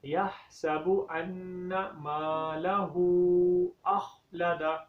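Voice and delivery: slow, careful tajweed recitation; correct recitation. Shown as a demonstration. Teacher recites the verse properly for students, slow chant. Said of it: "اب اس آیت کو صحیح طریقے سے پڑھیں".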